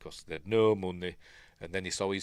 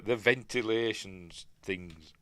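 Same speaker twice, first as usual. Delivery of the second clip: usual speaking voice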